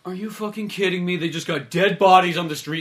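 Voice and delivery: high, loud mock outrage; indignant. Co-host voices a passer-by finding a body, hammed-up indignation.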